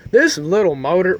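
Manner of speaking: ordinary talk, nothing marked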